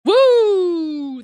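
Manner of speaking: loud, high woo; cheering whoop. Real, not acted. Cheer at super chat.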